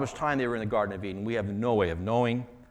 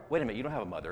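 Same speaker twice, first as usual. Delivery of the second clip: ordinary delivery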